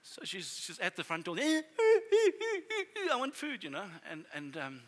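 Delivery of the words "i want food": hungry dog. Retold or imitated. Pastor voices hungry dog at door.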